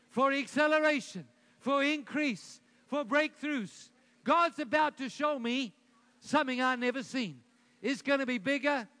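loud, high, emphatic faith confessions; enthusiastic declaration. Shown as a demonstration. Preacher leads congregation in confessions, loud and enthusiastic. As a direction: proclaim each phrase for a crowd to repeat. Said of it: "with enthusiasm".

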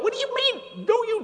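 a sharp high incredulous squeak; mock disbelief at the doubters; leaping incredulous question. Mocking rebuttal of church people.

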